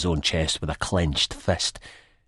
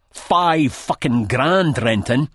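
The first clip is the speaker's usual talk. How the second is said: higher, louder, very slow threat; menacing demand; 9 semitones higher, 6 dB louder, much slower than usual. A narrator's reading. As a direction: drag out every word like a threat. Sick Boy states debt.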